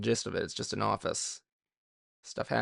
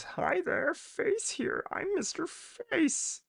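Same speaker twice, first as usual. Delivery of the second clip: put-on much higher, slow, cheery kids' host voice; mock Face host voice; 4 dB quieter, much slower than usual. Imitating Face the cartoon host for the viewers, very high. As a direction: squeak it out like a kids' TV puppet host.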